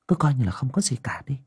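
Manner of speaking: fast, secretive whisper. A narrator's reading. Aunt telling Ngà to act normal, whispering. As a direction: hush it so nobody hears.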